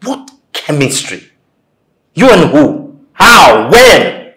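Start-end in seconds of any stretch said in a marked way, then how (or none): 2.1-4.3 loud, punched one-word questions; indignant disbelief. Acted. Denying any chemistry between them, loud and incredulous. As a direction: fire each question out hard.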